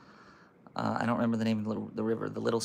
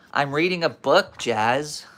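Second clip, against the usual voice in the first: loud, higher reply to an interruption; raised voice; 3 semitones higher, 7 dB louder, slower than usual. Real, not acted. Reader to Jazz interrupting the book, raised voice. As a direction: call it out over the interruption.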